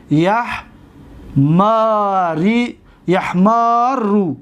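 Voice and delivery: syllable by syllable, very drawn out; teacher sounding out; slow demonstration. Shown as a demonstration. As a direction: build the word up piece by piece. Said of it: "باب هجده".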